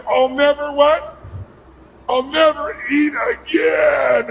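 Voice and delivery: slow, drawn-out mock vow; stuffed diner's vow. Speaker mimics a stuffed Thanksgiving diner, drawn-out mock vow. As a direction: groan it out slowly, mock-overstuffed. Acts out overstuffed diner vowing.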